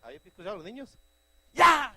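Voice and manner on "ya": sharp shouted high cry; karate shout. Retold or imitated. Children in karate class, a sharp shout. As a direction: yell it like a kid throwing a punch.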